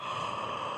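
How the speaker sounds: long, wide breathy yawn; bigger pretend yawn. Shown as a demonstration. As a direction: stretch the yawn out big. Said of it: "Can you yawn even bigger".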